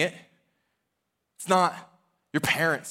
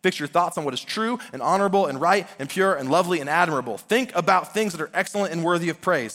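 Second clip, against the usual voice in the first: raised, earnest scripture reading voice; 3 semitones higher than usual. Acted. Pastor reads Philippians to the congregation, earnest proclamation.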